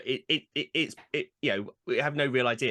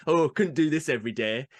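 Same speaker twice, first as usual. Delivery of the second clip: mock northerner's grumble; northern English accent. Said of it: "it does tend to be Northerners".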